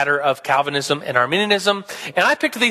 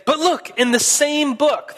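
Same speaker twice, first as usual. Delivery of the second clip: higher, slowed triumphant retort; mock Calvinist comeback; 8 semitones higher than usual. Teacher voicing a Calvinist countering with John, higher and slow. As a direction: lean in like you've caught them out. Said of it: "the Calvinist would say".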